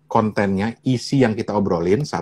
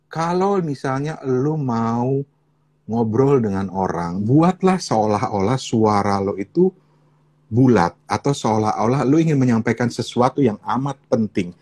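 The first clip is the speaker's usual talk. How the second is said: orderly measured speech; slower than usual. Shown as a demonstration. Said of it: "ngomongnya dengan lebih tertata".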